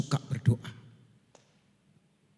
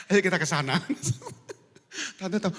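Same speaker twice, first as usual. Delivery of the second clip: laughing; 7 semitones higher, faster than usual. Real, not acted.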